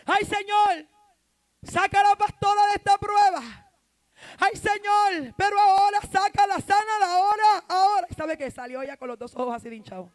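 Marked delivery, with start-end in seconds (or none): 0.1-8.1 high, loud pleading cry; loud imitated pleading prayer; desperate prayer. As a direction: imitate a sister wailing to God. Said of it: "se metió en un cuarto a orar".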